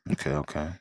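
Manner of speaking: usual speaking voice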